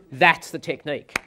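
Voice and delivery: ordinary talk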